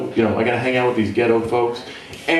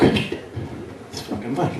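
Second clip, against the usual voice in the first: slow unvoiced muttered aside; whispered aside; 2 semitones lower, 7 dB quieter, much slower than usual. Real, not acted. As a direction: say it under your breath. Aside under breath.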